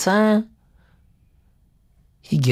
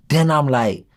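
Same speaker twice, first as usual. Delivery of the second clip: usual speaking voice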